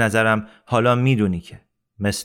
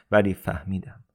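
ordinary delivery

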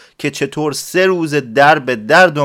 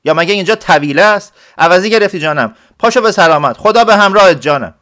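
louder, higher clerk snapping back; irritated scolding; 7 semitones higher, 5 dB louder than usual. Acted. Clerk scolds the noisy villager to hush, louder and irritated. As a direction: shoo him off with raised annoyance. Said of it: "صداش در اومد و گفت".

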